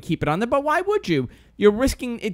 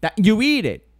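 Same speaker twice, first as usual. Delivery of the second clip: ordinary talk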